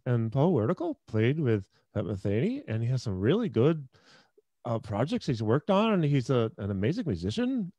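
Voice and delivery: every phrase rising at the end; uptalk. Retold or imitated. Mimicking the San Jose techie clique, rising endings. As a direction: imitate techie uptalk, each clause swinging up. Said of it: "And it was all upswings".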